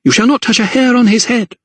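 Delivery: high, fierce protective cry; defiant high-pitched command; shouted defiance. A narrator's reading. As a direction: defend your child, pitch well up. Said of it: "shouted Lydia".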